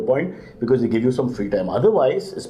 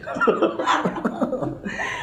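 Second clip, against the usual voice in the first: giggle; 12 semitones higher, 3 dB quieter than usual. Real, not acted. Host laughing at tiger remark.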